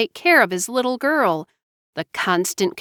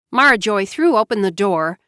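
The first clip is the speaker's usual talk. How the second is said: usual speaking voice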